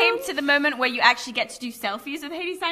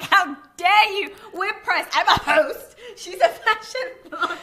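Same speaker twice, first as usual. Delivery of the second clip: slow, higher-pitched mock outrage; retold indignation; 5 semitones higher, slower than usual. Snubbed host protests to the PR, mock indignant. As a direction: puff up with offended self-importance. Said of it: "I'm pissed off".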